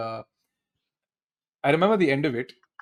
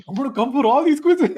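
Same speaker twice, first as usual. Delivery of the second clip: higher and louder, laughing with delight; imitating overjoyed; 10 semitones higher, 6 dB louder than usual. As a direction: gush like a thrilled winner. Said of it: "So happy".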